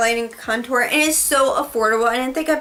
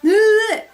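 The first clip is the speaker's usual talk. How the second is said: laugh; 9 semitones higher, 5 dB louder than usual. Real, not acted. Laughs at being out of breath.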